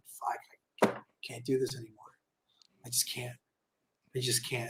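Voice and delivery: soft, low, beaten-down mutter; defeated hush. Retold or imitated. Imagining quitting the business, soft defeated mutter. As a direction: let it drain out, giving up quietly. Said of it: "just saying".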